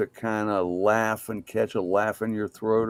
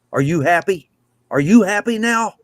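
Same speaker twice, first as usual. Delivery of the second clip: put-on little boy sassing; 7 semitones higher, 8 dB louder than usual. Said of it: "he turned around and he said".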